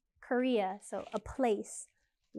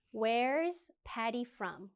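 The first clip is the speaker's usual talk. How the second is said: "where's Patty from?" slow with the tune falling at the end; slow falling question; much slower than usual. Shown as a demonstration. Teacher shows students the falling tune of the question. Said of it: "the intonation goes down a little bit".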